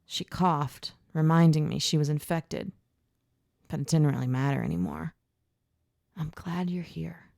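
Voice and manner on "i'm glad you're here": soft whisper. Acted. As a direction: whisper it gently. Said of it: "I whispered to her".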